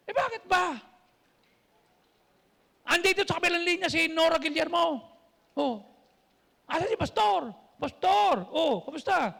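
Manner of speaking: high, loud, excited voices; excited phone call. Retold or imitated. Friends shout happy greetings to the pastor over the phone, high and loud. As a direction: act out a delighted phone greeting.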